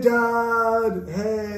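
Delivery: high, drawn-out son voice. Acted. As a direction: call out to dad in a put-on high voice. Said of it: "I'm like, This is my son".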